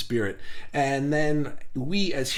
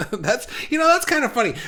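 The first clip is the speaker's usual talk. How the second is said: laughing; 8 semitones higher, faster than usual. Real, not acted. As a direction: giggle while finding it funny. Laughing about the TGI Friday joke.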